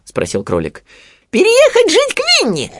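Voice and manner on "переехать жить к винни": very high squeaky piglet, delighted; joyful exclamation. A narrator's reading. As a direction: squeal it out loud with joy. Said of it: "радостно воскликнул Хрюня". (радостный писк поросёнка)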